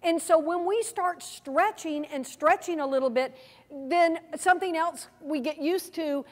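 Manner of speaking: ordinary talk, nothing marked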